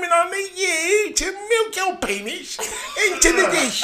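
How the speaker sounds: cracking up while quoting the doctor; can barely get the words out for laughing; laughing through it. Retold or imitated. Laughing as he retells the doctor's order.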